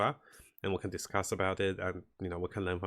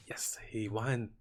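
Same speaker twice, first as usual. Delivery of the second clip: whisper; 4 dB quieter, much slower than usual. Real, not acted. Relieved tense moment in match.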